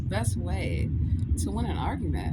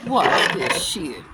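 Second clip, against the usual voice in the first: low, loud, slow boast; cocky challenge; 8 semitones lower, 13 dB louder, much slower than usual. Acted. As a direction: throw down a loud, slow dare. Acting out a cocky courtroom boast.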